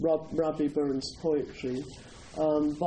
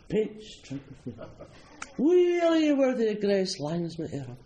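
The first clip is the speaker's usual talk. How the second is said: mock Scottish blessing; 5 semitones higher than usual. Speaker in full robes blessing diners, mock-solemn Scots recitation. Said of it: "the Ode to the Haggis".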